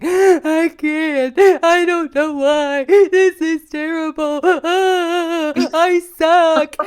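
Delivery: high, drawn-out hammed-up wailing; mock crying. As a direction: wail it out slowly like an exaggerated sobbing breakdown. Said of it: "crying, going".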